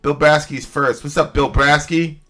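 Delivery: ordinary talk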